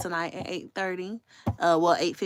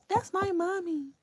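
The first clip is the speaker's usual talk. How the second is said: put-on high, slow little-kid voice; a toddler proudly naming his mother; imitating a little boy; 13 semitones higher than usual. Mother voicing her young son at the door, high and slow. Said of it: "Samir said".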